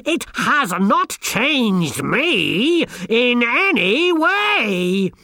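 high, loud, drawn-out megalomaniac rant; unhinged boastful wizard. A narrator's reading. Wizard insists he controls the hiver, ranting madly. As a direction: stretch each word in swelling, high-pitched defiance.

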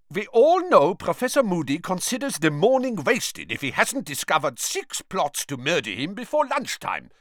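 high, projected voice for Karkaroff; loud character voice. A narrator's reading. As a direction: say it out loudly, pitched well up. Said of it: "said Karkaroff loudly".

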